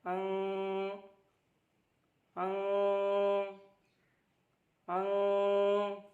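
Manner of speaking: अंग drawn out very slowly, the ङ hum held long; held nasal demo. Shown as a demonstration. Teacher repeats अंग slowly for pupils, nasal held. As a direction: hum the nasal of अंग through the nose before releasing. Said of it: "नाक में ले जाकर एक second तक ठहरना है".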